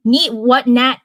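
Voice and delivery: plain reading voice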